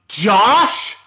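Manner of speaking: loud, high incredulous question; acted disbelief. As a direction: blurt the name, rising in outrage. Acted scene, hearing who was chosen.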